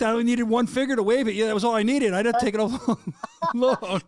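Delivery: loud, laughing protest; laughing defense. Real, not acted. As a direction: laugh while defending the wave. Defending his driving, laughing.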